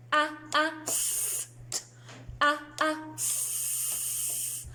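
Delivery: "S" as a long hiss, "P" and "T" as voiceless pops; letter sounds. Shown as a demonstration. Teacher leading children in letter sounds, isolated phonics sounds. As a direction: sound out "Ah" and "Eh" short, "S" hissed. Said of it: "say the sounds with me".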